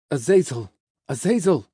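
loud call of a name; calling out urgently. Acted. Someone calls the fallen angel.

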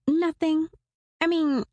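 put-on young woman voice. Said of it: "she sighed".

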